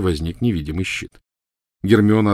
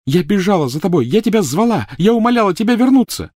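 put-on furious girl; 13 semitones higher, 5 dB louder than usual. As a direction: spit each word out with a blow. Hermione accusing Ron.

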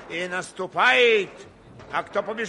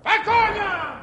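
battle cry; 7 dB louder than usual. Acted. Repeated charge command.

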